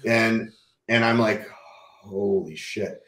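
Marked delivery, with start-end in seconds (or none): 1.5-3.0 drawn-out, stunned disbelief, softly; low-key, long-stretched astonishment; retold stunned reaction. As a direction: let it out very slowly, hushed. Stunned reaction to seeing tumor.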